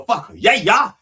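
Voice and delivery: loud, high "Fuck yeah, yeah" with an added syllable; energetic extra syllable. Shown as a demonstration. Preacher showing off his energy, extra syllable on "yeah". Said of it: "got the energy to throw in an extra syllable".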